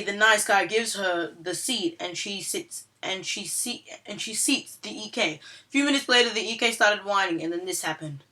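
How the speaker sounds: usual speaking voice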